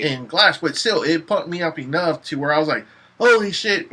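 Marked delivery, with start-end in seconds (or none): 3.2-3.9 loud, high burst of amazement; retold amazed excitement. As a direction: relive the thrill of a film twist. Retells being pumped by film twist.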